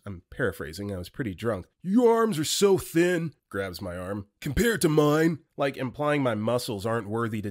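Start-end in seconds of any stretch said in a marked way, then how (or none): 1.8-3.3 higher, louder, drawn-out jeer; acted-out club bully taunt; mocking taunt. Drunk club guy taunting his arms. 4.4-5.4 mocking brag. Same club guy bragging.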